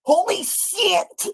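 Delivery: drawn-out, high disbelief; stunned holy shit. Real, not acted. Reactor gasping at the clip, slow high disbelief.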